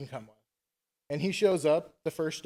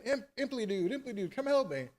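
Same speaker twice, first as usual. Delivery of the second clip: retold plea pitched way up; mock scream for help; 6 semitones higher than usual. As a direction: call for rescue in a high voice, half-hearted scream. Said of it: "starts screaming again".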